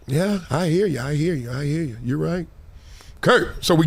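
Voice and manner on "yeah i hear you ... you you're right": softer, lower, half-hearted yes from the coach; reluctant coach agreeing. Retold or imitated. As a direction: give in without meaning it, lower and softer. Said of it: "He like".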